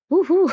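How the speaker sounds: high, loud "woo-hoo"; short excited hoot before a laugh; playful whoop. Real, not acted. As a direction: whoop teasingly at the juicy bit. Reacting to a steamy kiss scene.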